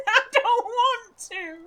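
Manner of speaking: laughing while saying it; slow, nervous giggling protest. Real, not acted. Nervous laugh facing the descent.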